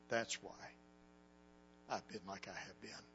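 quiet heavy grief. Retold or imitated. Grieving man in locker room.

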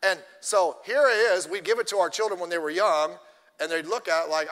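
ordinary talk, nothing marked

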